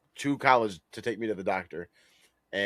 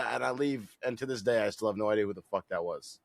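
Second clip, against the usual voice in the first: usual speaking voice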